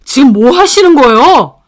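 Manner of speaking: loud, indignant demand; confronting. Acted. Husband confronting his mother who threw a tissue box, loud protest. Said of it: "남편이 어머님께 따졌죠".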